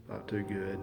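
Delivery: quiet, downcast mumble; imitating a glum friend on the phone; gloomy friend. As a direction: sound like someone just died. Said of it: "That's how he sounded".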